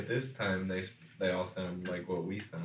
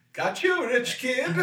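high, loud gotcha taunt; cocky street kid; 6 dB louder, slower than usual. Retold or imitated. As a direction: gloat like a scrappy kid. Voicing a smart poor kid.

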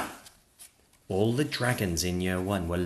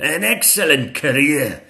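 loud, higher bravo; praising dragon teacher; 6 semitones higher, 8 dB louder than usual. Acted. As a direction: applaud your pupils aloud.

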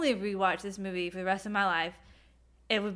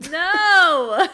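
laughing hard protest; cracking up while saying no; 7 semitones higher, 9 dB louder than usual. Real, not acted. Laughing protest at whipped cream joke.

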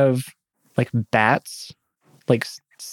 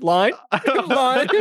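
mock actor calling line; 9 semitones higher than usual. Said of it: "I'm like".

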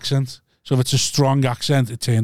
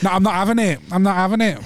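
mock Essex, raised pitch; copied Essex accent; 5 semitones higher than usual. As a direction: imitate an emphatic Essex refusal. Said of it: "I'll try and copy you".

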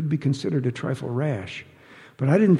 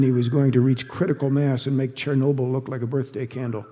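plain reading voice

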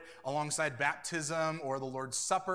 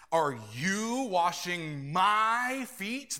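YOU and MY drawn out, slow and louder; stress on YOU and MY; 3 semitones higher, 5 dB louder, much slower than usual. Acted. Preacher reads Peter's question with Greek emphasis, stressing YOU, MY. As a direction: lean long on "you" and "my", rising in disbelief at "feet". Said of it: "That's the emphasis in the Greek".

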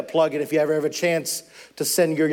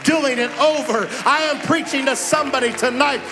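full-throated revival preaching; preaching at full force; 7 semitones higher than usual. Acted. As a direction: drive the congregation toward the altar call. Sermon climax.

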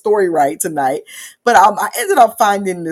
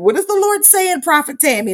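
much higher, lilting call of her name; bishop's sing-song greeting; 8 semitones higher than usual. Retold or imitated. Bishop greeting Prophet Tammy at church, high lilting call. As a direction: imitate the bishop hailing her prophet brightly. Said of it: "That's how she would say".

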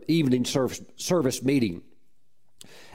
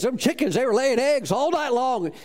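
higher, eager farmer; excited; 8 semitones higher than usual. Retold or imitated. Farmer Red telling the preacher about eggs, excited and higher. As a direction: tell it with wonder.

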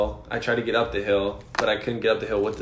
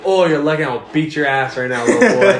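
loud retold threat, jumping high at the end; mock threat; 4 semitones higher, 8 dB louder than usual. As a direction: threaten a kid loudly, playing it for laughs. Acted threat to a kid.

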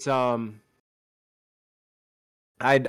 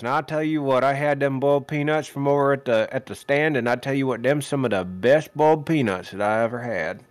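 Southern game announcer; faster than usual. Retold or imitated. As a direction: rattle it off like a folksy Southern announcer. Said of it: "the announcer from that football game".

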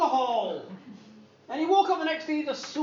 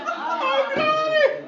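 put-on high, loud child cry of Granny; child protesting; 13 semitones higher, 6 dB louder, much slower than usual. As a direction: cry out like a scared kid.

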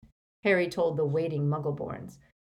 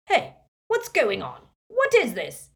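higher, louder bewildered outcry; startled demand; 8 semitones higher, 6 dB louder than usual. A narrator's reading. A wizard baffled by two Regs, alarmed.

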